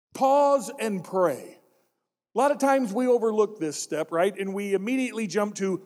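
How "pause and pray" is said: slow, deliberate "Pause and pray"; slow emphasis. Acted. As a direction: spell out "Pause and pray" word by word. Step repeated slowly.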